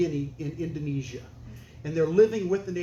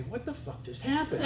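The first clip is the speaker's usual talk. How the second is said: shocked couple; 7 semitones higher, 5 dB quieter than usual. Retold or imitated. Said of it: "look at each other and say".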